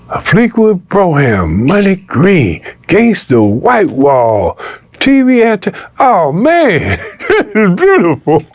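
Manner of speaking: awed delight breaking into a laugh; retold amazed admiration. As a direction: marvel at the car piece by piece. Retold amazed reaction to gifted car.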